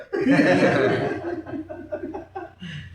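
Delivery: loud, high burst of laughter; real hearty laugh. Real, not acted. Teacher joking with students about listening, loud high laugh. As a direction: crack up for a few seconds.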